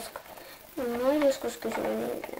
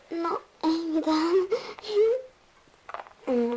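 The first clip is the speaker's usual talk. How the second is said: ordinary delivery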